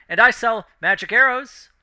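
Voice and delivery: high, loud eager interjection; half-elf woman piping in. Acted. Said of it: "the half-elf woman pipes in".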